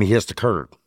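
plain reading voice

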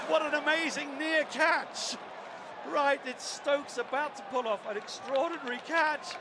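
lively cricket play-by-play call; cricket commentator. Real, not acted. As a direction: call the chase ball by ball. Live play-by-play of final overs.

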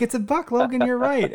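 put-on high, bright echo of the child's excitement; match the toddler's high excitement; dad answering his daughter on the phone, high and excited.

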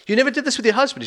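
mock-incredulous query, pitched well up; surprised question. Escort questioning a widow's confession, imitated surprise.

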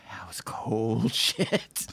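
hushed, slow whisper of awe; awed whisper. Real, not acted. Stunned listener whispering about a cold movie line. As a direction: breathe it out slowly, impressed.

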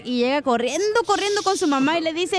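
ordinary talk

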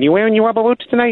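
high, quick mock caller; prank caller voice. Caller asks the bored sportscaster about rubber boots, high mock voice. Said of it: "And he said".

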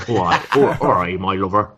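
half-hearted Bristol accent; a reluctant try at a Bristol accent. Shown as a demonstration. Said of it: "could you give us a little bit of a taste of a Bristol accent".

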